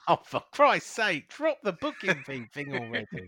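loud, high-pitched mock exasperation; exasperated. Real, not acted. Co-host telling host to drop the booking fee joke, exasperated. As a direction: protest it loudly, half laughing. Co-host fed up with running gag.

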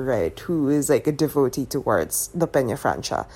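normal talking voice

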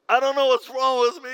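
high, loud, anguished cry of self-blame; hammed-up cry of a man falling apart; desperate wail. Addict wailing to his pastor in his office, high and loud.

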